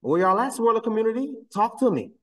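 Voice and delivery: high-pitched taunting summons; calling out. Real, not acted. Pressing the silent swirler community for answers, calling out. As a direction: keep calling for them, voice well up high.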